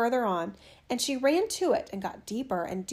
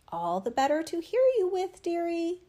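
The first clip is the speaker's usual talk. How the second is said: wolf voice; 6 semitones higher than usual. A narrator's reading. Wolf disguised as grandmother.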